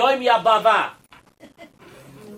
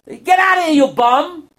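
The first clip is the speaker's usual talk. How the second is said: loud high angry guard; guard yelling; 7 semitones higher, 4 dB louder than usual. Acted. Palace guard shouting the filthy prince away, angry. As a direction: bark him away from the gate.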